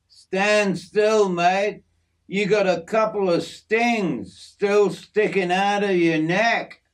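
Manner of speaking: lightly voiced bushman giving orders; Jim, instructing. A narrator's reading. Jim removing stings from Yorkie's neck, instructing.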